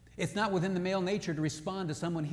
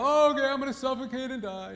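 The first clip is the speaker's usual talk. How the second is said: higher, hammed-up whiny voice; mock husband; 7 semitones higher, 6 dB louder than usual. Husband mocking giving in, in a higher whiny voice. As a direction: play a husband pretending to give in.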